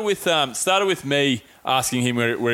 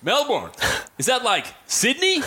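higher, slow, puzzled mock American; puzzled American friend; 8 semitones higher, slower than usual. Clueless American mate asking about Melbourne, puzzled imitation. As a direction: draw out the clueless question, pitched up. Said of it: "And he goes".

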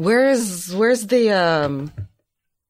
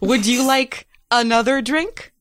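character voice; 7 semitones higher than usual. Acted. Player voices robot Bailey.

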